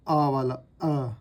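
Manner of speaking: very slow, stretched "अ"; sounding out the vowel. Shown as a demonstration. Teacher demonstrating the अ vowel to students. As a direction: hold the short "अ" sound long. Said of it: "last में हमने vowel सीखा था".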